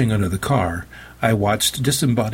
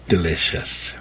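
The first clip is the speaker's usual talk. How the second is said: savoring whisper; 3 semitones lower than usual. Acted. Vampire savors victim.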